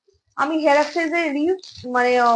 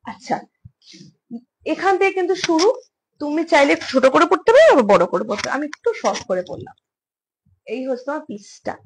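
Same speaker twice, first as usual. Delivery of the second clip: ordinary talk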